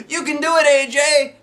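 high, loud encouragement; shouted. Acted. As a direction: cheer him on across the field. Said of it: "shouted Michael".

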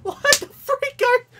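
very high, loud cracking squeal; outraged disbelief. Real, not acted. Player outraged at the game, squealing in disbelief.

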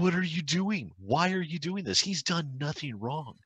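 high-pitched exasperated plea; imitated protest. Recounting a protest over the videos, high and exasperated. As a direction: plead high in mock outrage.

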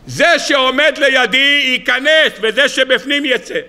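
high, slow, ringing pronouncement; solemn decree. Acted. Rabbi by the pit commands living and dead, solemn decree. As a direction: declare it slowly, pitched well up.

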